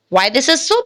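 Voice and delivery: ordinary delivery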